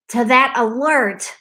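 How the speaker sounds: ordinary talk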